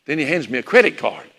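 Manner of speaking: usual speaking voice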